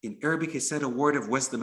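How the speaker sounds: ordinary delivery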